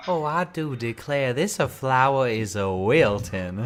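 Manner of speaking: slow, loud, high Southern belle-style drawl; hammed-up Louisiana drawl, drawn right out; Louisiana accent. Joining in on the cartoon cousin, mock Louisiana accent. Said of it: "like, Louisiana or whatever".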